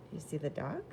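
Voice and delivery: ordinary delivery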